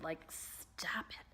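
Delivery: slow breathy whisper; whispered self-scold. Real, not acted. Telling herself off about the mirror, slow whisper. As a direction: scold yourself under your breath.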